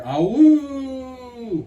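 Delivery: high, loud train whoo; train whistle imitation. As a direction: call it like a far train whistle.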